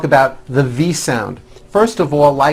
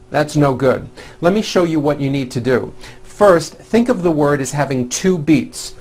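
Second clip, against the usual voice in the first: ordinary delivery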